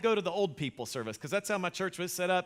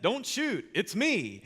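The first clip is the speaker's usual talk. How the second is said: sarcastic; 3 semitones higher, much slower than usual. Retold or imitated. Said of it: "So very sarcastically".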